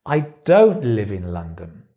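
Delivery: "don't" punched, the rest falling away; stress on DON'T. Shown as a demonstration. Teacher showing how stress changes meaning, "don't" stressed. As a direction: hit the word "don't", then let it fall. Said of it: "where you put the stress changes the meaning".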